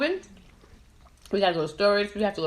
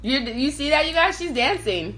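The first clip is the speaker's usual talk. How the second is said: excited call-out; 7 semitones higher, 4 dB louder than usual. Real, not acted. Calling out to viewers, friend dancing.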